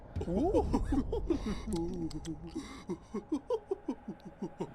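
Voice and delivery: a high, quiet, breathy giggle; playful little laugh; soft character laugh. Acted. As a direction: chuckle as the charm seller. Salubra's laugh after her lines.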